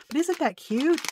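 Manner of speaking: high, bright gush of excitement; excited. Real, not acted. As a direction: squeal it with delight. Excited over card idea.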